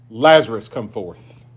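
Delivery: ordinary delivery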